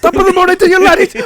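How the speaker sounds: high, loud over-the-top Irish impression; mock Irish greeting. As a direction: shout the greeting in a big mock Irish lilt.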